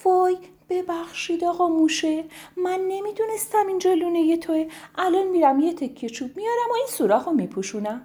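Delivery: high, apologetic bird voice; high flustered woodpecker apologising. Acted. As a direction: fluster out a sorry, speeding up. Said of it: "خانم دارکوب ... گفت".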